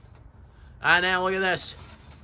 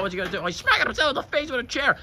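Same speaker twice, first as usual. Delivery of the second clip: usual speaking voice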